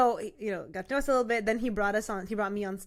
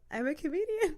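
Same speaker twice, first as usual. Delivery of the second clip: timid admission; 8 semitones higher than usual. Retold or imitated. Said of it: "And I went like this".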